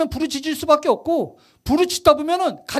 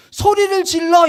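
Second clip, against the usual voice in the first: retold shout; 6 semitones higher, 5 dB louder, slower than usual. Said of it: "갑자기 소리를 질렀어요".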